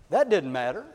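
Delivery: usual speaking voice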